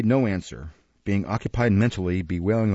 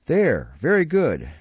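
gleeful; 5 semitones higher than usual. A narrator's reading. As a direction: savour it, delighted.

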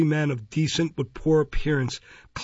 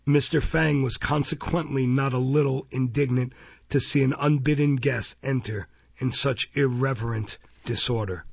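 ordinary delivery, nothing marked

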